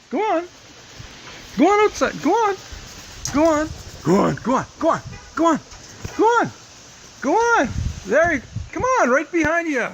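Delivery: high urging sing-song; coaxing a wild bird. Real, not acted. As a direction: urge it out, high and light. Shooing bird outside.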